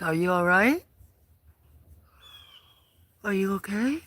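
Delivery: normal talking voice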